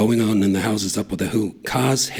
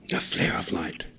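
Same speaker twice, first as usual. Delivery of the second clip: soft whisper; 2 semitones lower, 7 dB quieter than usual. A narrator's reading. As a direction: breathe it in awe. Whispered after chant.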